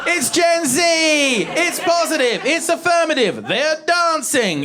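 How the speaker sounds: high-pitched shouted exclamations; comic high-pitched outburst; exasperated rant. Acted. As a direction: yell it out in mock cheer, one burst at a time. Comedian's exasperated rant about Gen Z.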